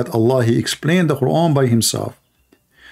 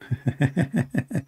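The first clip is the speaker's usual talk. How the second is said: quiet amused chuckle; brief breathy laugh; soft laugh; 3 semitones higher, 8 dB quieter than usual. Real, not acted. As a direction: laugh under your breath. Laughing at caller's donkey sound.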